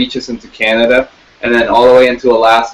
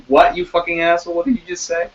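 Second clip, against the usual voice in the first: mock outrage; 7 semitones higher, 4 dB quieter, faster than usual. Mock rapper outrage at pundit.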